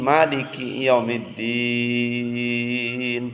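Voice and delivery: "মালিকি" with the first vowel held long for three alifs, chanted slowly; three alif elongation. Shown as a demonstration. Teacher showing students a three alif stretch in chant. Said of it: "তিন আলিফ পরিমাণ টানতে হবে".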